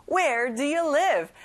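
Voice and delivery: slow, emphatic Where do you live; slow model sentence. Shown as a demonstration. Teacher models the question slowly, stressing where. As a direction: stretch each word for the class. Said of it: "this is our next sentence".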